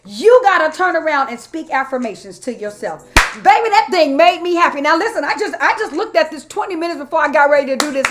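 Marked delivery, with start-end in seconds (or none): 0.0-3.0 raised, rhythmic preacher cadence; building preaching. Acted. Preacher relaying what she heard God saying, fervent. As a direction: drive each "after all" like a sermon refrain.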